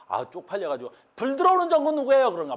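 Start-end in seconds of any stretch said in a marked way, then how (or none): 1.1-2.3 mock exam question. Teacher acting out a silly exam question, high mocking delivery. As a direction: pitch it up and call out the question. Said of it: "이런 거 묻는 거야".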